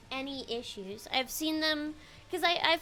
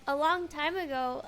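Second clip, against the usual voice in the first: ordinary talk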